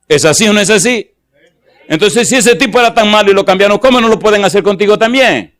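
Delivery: predicación alta y fuerte, con fervor; predicación enérgica. Acted. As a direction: exhorta a la congregación con fuerza. Predica con fuerza al público. (predicación con fervor, voz alta)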